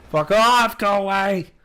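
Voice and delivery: panicked shout at a monster; yelling while running away. Real, not acted. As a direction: yell it while fleeing in terror. Fleeing monster in horror game.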